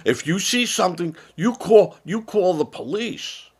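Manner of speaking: hammed-up stern announcement; mocking authorities. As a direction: lampoon a government order to snitch. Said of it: "And they go".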